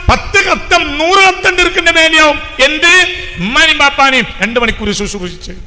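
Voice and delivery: fiery preaching. Acted. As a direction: hammer it home to the congregation. Preaching at full force.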